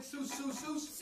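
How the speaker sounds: put-on "Soos" said high, chanted for children to repeat; "soos" high, in a sing-song lead; Irish word soos. Shown as a demonstration. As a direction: chant "soos" brightly for the kids. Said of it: "Can you all say it?".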